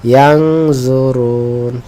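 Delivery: very slow, stretched Arabic word; ধীরে টেনে পড়া; slow recitation. Shown as a demonstration. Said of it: "এই শব্দে র আছে?".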